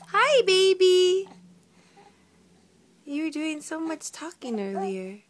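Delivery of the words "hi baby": high, loud and slow sing-song hello. Real, not acted. Mother greeting her baby, high and stretched out.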